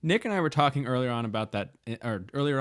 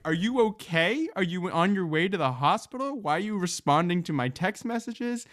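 shocked disbelief; 8 semitones higher than usual. Retold or imitated. Retelling her shocked texts to the injured friend, high and alarmed. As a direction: voice shooting up in worried disbelief. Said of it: "I was shocked".

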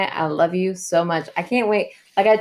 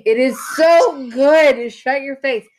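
"so" drawn out slow, higher and louder; gushing insistence; 7 semitones higher, 6 dB louder, slower than usual. Real, not acted. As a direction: stretch out "so" to insist the show is great. Defending her show in a playful argument.

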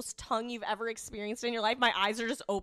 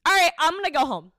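loud exit line; 6 semitones higher, 9 dB louder than usual. Retold or imitated. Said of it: "So I'm like".

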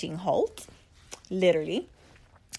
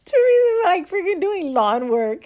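much higher and louder, breaking into laughter; laughing hard; 9 semitones higher, 6 dB louder than usual. Real, not acted. Telling her viewers about the yard work, laughing hard. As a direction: squeal it out through laughter.

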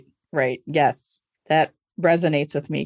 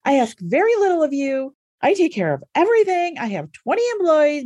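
raised, indignant mock lecture to her husband; exasperated speech; 8 semitones higher than usual. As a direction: list your grievances, pitched up and put-upon. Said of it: "give him this whole speech".

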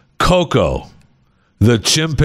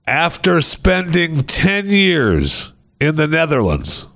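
slow, higher-pitched announcer delivery; news read; 5 semitones higher, slower than usual. Acted. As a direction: present the story slowly and grandly. Reads a good-news story aloud.

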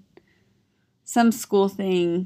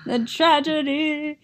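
high, drawn-out mock lament; hammed-up tragic sigh of words; 7 semitones higher, slower than usual. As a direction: lament it slowly with playful melodrama. Mock lament over eaten yogurts.

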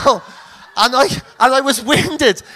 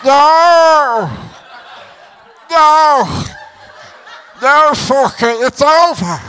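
slow, drawn-out hammed-up pleading; mock panic; slower than usual. Downed boxer begging the referee for help, mock-dying wail. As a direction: wail as if life is slipping away. Winded boxer begs as he's counted out.

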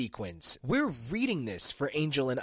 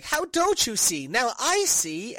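much higher, louder, last words slow; 8 semitones higher, 6 dB louder, slower than usual. Retold or imitated. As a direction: act out an overblown epiphany.

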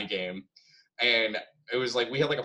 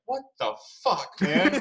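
mock disbelief; 8 semitones higher, 3 dB louder than usual. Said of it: "he's just like".

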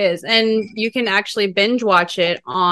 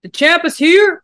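announcer voice; 10 semitones higher, 5 dB louder than usual. Pretend. Echoing the wrestling entrance.

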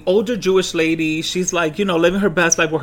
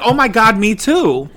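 louder, higher gush of delight; thrilled to find a match; excited; 5 semitones higher, 5 dB louder than usual. Retold or imitated. Old lady squealing to him in delight. Lady's excited reply retold.

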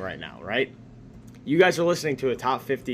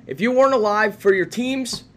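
higher, louder show-host delivery; announcing the question; 6 semitones higher, 4 dB louder than usual. Real, not acted. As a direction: pose it to the audience as a big question. Announces the segment's big question.

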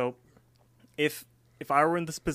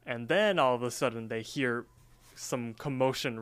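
ordinary delivery

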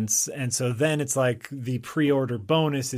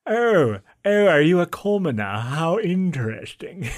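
high, loud mock-posh English; mocking posh accent; 5 semitones higher, 5 dB louder than usual. Co-host mocks posh accent to a commoner, high and loud. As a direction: hoot it like a snooty aristocrat, posh English. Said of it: "making fun of Yahtzee's accent".

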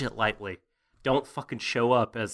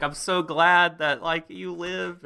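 tearful relief; 8 semitones higher, 4 dB louder, slower than usual. Retold or imitated. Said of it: "he's just weeping into his shoulder".

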